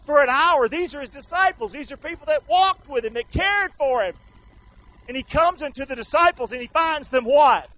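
loud, high-pitched sermon delivery; full-force preaching. Real, not acted. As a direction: drive the Bible story home to the congregation. Preaches the garden scene at full force.

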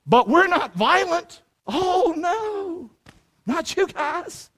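high-pitched, drawn-out fake innocence; mocking sarcasm. Preacher mocks a claim of nonviolence, sarcastic. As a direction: protest innocence in a slow, high sing-song.

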